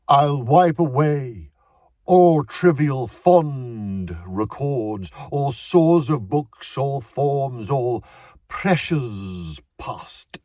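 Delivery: booming, slow, overblown Shakespeare, words pulled apart; hammy theatre voice. A narrator's reading. Actor demonstrating his line to the director, hammy and booming. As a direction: declaim it like a ham actor showing off. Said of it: "His voice became a theatre voice".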